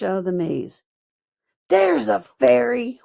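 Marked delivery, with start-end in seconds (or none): 1.7-3.0 louder, higher goblin cry; goblin shout. A narrator's reading. Goblin points at Fern and shouts to the other goblin. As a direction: yell out the find. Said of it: "one of them shouted".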